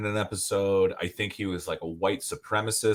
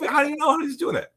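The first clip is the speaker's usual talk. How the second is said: exasperated; faster than usual. Retold or imitated. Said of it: "I was like".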